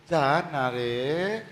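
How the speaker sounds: soft slow half-hearted go away; timid plea. Shown as a demonstration. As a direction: plead limply, no authority. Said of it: "ऐसे नहीं बोलते".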